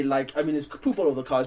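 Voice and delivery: ordinary talk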